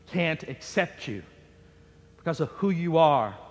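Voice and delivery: the devil telling anyone they are unloved, low and hushed; voice of evil. Acted. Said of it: "the true voice of evil".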